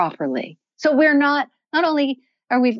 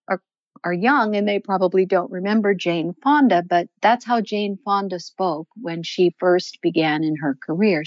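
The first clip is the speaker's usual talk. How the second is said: ordinary talk, nothing marked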